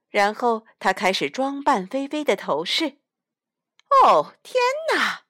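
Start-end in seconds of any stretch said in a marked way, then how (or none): 4.0-5.3 high, startled "oh my goodness"; a sudden alarmed cry of dismay; dismayed gasp. A narrator's reading. Mrs Jones realising she forgot the hairspray, startled and high.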